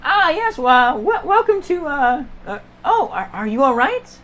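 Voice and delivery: high-pitched, halting shopkeeper welcome; flustered shopkeeper. Acted. Shopkeeper to a panting customer, high flustered welcome. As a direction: greet a customer, then falter in concern. GM plays shopkeeper greeting visitor.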